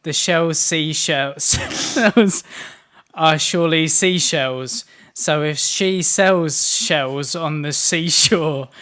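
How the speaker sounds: slow, halting tongue twister under a speech jammer; slow, stumbling tongue twister. Shown as a demonstration. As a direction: stumble slowly through each word. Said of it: "it's so annoying".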